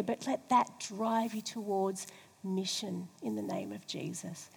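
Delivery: ordinary delivery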